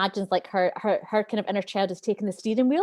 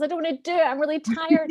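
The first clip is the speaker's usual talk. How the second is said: put-on higher, lightly whining child voice; whiny inner child; 7 semitones higher than usual. A tired inner child refusing to get up, mildly whiny. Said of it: "that part that goes".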